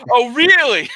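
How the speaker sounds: loud, high indignant wife; wife's voice. Retold or imitated. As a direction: shriek it like a suspicious wife. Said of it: "Brizzy comes in".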